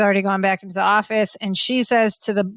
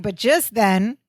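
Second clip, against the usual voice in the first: normal talking voice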